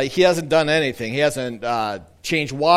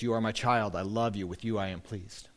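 tender blessing; 7 semitones lower, 9 dB quieter than usual. Acted. God's words to the crowd.

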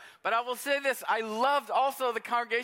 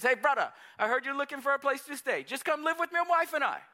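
friendly Hawaiian pidgin English, pitched up; imitating a big-hearted Samoan man in pidgin English; 5 semitones higher than usual. Samoan church brother offering his home, pidgin English. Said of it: "They talk pidgin English".

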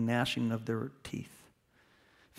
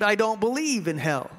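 higher, exclaimed protest; exclaiming; 7 semitones higher, 4 dB louder than usual. A narrator's reading. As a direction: blurt it out in defiance. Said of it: "But I exclaimed".

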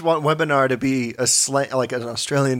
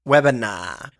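put-on Australian accent; 5 semitones lower, slower than usual. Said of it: "hear Australian people say it".

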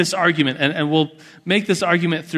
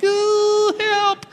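put-on very high, whimpering child plea; kid begging for help; 14 semitones higher than usual. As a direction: whimper like a small boy who needs rescue.

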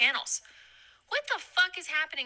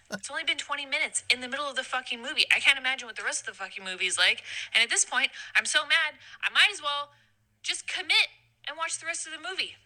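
normal talking voice

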